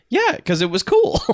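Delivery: high, slow, matter-of-fact "cool"; like it's the most obvious thing; mock obvious answer. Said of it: "It's like".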